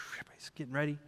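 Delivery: hushed whisper aside. Real, not acted. Dad setting the scene at the pool, whispered. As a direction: whisper it like a secret.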